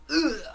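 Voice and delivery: short, loud, high cry; crushed yelp. Acted. The tree crushes the zombie girl, crushed yelp. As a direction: yelp as if hit, cartoon style.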